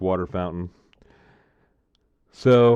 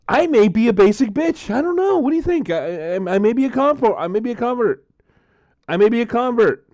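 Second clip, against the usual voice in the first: high, giddy squealing delight; excited squeal; 11 semitones higher, 3 dB louder than usual. Real, not acted. Thrilled after tasting the strawberry seltzer, squealing high. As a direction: gush like he's been won over.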